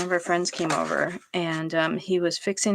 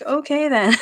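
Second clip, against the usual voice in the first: amused reply; 8 semitones higher, 6 dB louder than usual. Retold or imitated. Friend awkwardly reacts, laughing.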